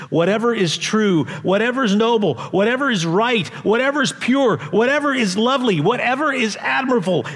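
pastor's rising exhortation, full force; building preaching. Acted. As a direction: build the list like a rising litany.

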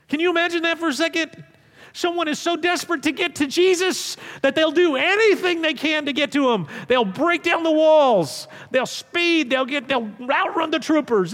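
ordinary talk, nothing marked

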